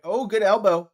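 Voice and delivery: loud excited call of a good elbow; sudden loud approval. Real, not acted. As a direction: hype reaction to the elbow. Excited reaction to fight.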